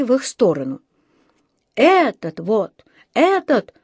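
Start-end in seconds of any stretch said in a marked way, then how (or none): 1.7-3.7 drawn-out cry, jumping high on the repeat; mild wail. A narrator's reading. Musician points out the bear to police, wailing. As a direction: wail it accusingly, getting higher. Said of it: "завопил музыкант".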